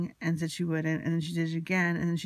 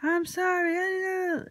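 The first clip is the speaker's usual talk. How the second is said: high-pitched mock screamed apology; imitated screaming; 13 semitones higher, 4 dB louder than usual. She screams an apology after being told off, imitated high. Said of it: "she starts screaming".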